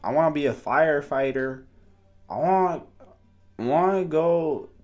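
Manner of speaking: put-on high, loud little kid; playing a small child; preschooler voice. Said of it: "here's my little kid voice".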